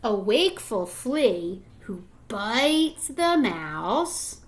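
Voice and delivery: drawn-out story voice, each clause hung in the air; slow suspense reading. Acted. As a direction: stretch out the chain of bites for suspense.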